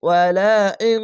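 drawn-out chanted ওয়ালা ইং with the ইং held long; slow melodic Quran reading; Quranic recitation. Shown as a demonstration. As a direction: recite ওয়ালা ইং slowly in tajweed style. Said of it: "হামজা নুন জের ইং".

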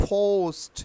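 "পোস্ট" as phoust, long gliding o; corrected pronunciation. Shown as a demonstration. Teacher drilling learners, "পোস্ট" with ou glide. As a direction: puff the p and stretch the o in "পোস্ট". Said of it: "একটু close sound হবে".

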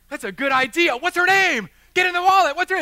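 loud, high-pitched urgent yell; re-enacted emergency shouting; frantic shouted orders. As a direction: bark it out in the thick of an emergency.

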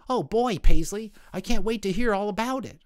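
high, bright little-boy voice, lightly voiced; a small sick boy perking up with joy; eager little brother. Davy in his sickbed, thrilled by his sister's surprise.

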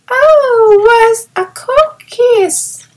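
put-on very high, slow child's exclamation; little boy thrilled by the kiss; delighted small child; eager cry. A narrator's reading. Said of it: "cried Peony".

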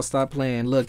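ordinary delivery

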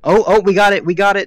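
thrilled at the find; excited. Real, not acted. Found freezing temperature.

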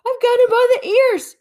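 high, loud eager shout; excited shout. A narrator's reading. Bear grabbing the creature's ears, excited shout. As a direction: grab hold with glee.

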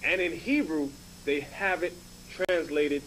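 normal talking voice

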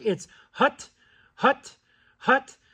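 short single goose clucks; goose cluck call. Shown as a demonstration. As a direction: voice the hut like a call. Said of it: "on a regular standard cluck".

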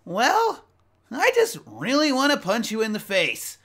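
high, loud brash hero voice; cocky hero. Retold or imitated. Hero brushing off the villain's speech, high and brash. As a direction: blurt it out, unimpressed and cheeky. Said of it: "And the hero just goes".

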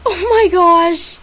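high, loud, slow cry of delight; excited yell. Real, not acted. Still over the rare gift, excited yell. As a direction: still thrilled, a bit calmer.

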